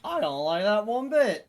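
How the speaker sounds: high, loud alarmed outburst; alarmed shout. Real, not acted. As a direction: blurt it out in alarm. Player reacts to threat.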